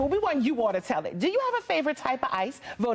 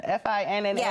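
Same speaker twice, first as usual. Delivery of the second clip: usual speaking voice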